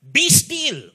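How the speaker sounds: high, loud command to the storm; commanding rebuke. Acted. Jesus commanding the storm to be still, loud and high. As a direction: order the wind to stop. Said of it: "Sa ibang translation".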